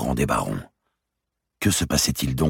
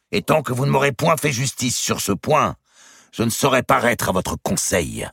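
higher and louder, a challenge to his cousin; Robert's defiant cry; 12 semitones higher, 4 dB louder than usual. A narrator's reading. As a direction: seize the ruling and turn it against him. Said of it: "s'écria-t-il".

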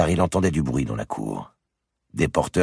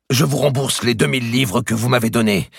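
higher, louder urgent prelate; pleading archbishop; 10 semitones higher, 6 dB louder than usual. A narrator's reading. The archbishop bargains with the merchant, high and urgent. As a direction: press the offer hard, higher.

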